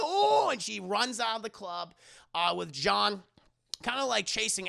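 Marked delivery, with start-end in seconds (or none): 0.0-0.5 high, loud startled "oh"; mock startled cry. Narrator acts Ellie fleeing the ghost, high startled cry. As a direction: yelp in mock fright. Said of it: "and she's like".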